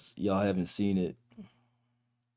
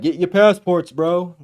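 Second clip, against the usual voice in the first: louder, higher mock bro rallying cry; hyped-up guys; 7 semitones higher, 8 dB louder than usual. Streamer mimics guys pushing passports online, hyped-up and loud. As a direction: imitate guys urging each other on, loud and high. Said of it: "Guys are like".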